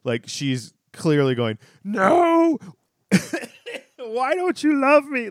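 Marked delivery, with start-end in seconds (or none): 1.8-5.3 high, loud hammed-up wail; weird high voice. Mocking Daphne's hammed-up cry to Niles, in a weird high wail. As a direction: ham up a pleading cry. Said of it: "in a weird voice, in a weird inflection".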